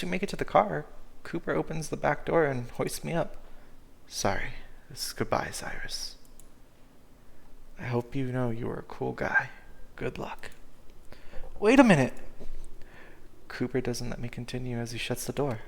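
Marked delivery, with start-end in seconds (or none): 4.1-10.5 whispered goodbye. Acted. Cooper bidding Cyrus goodbye at the van, hushed whisper. 11.6-12.1 higher, much louder cry to stop him; desperate shout. Acted. Cyrus begging Cooper not to leave, shouted.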